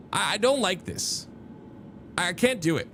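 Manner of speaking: usual speaking voice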